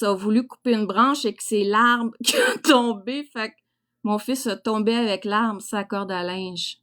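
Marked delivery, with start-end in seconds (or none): none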